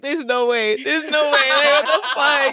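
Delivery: loud, laughing disbelief; retold loud amazement; incredulous. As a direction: blurt it out, stunned and cracking up. Acts out disbelief at teacher's antics.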